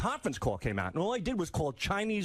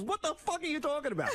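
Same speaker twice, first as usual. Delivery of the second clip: high-pitched indignant outburst; mock outrage; 11 semitones higher than usual. Pranked dad to sewer man, hammed-up outrage. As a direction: imitate an incredulous, furious dad.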